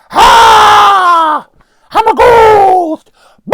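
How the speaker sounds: very high, loud, slow mock ghost yell; mock ghost shout. Reviewer mocking the film's jump scares, loud high mock shout. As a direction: shriek it like a cartoon ghost jumping out. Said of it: "it all turns into a".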